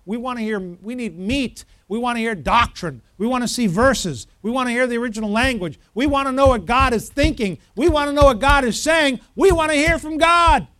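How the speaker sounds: insistent plea swelling to a loud cry; acted congregation clamoring, rising to a shout; building demand. Voicing congregation's pleas, building to shout.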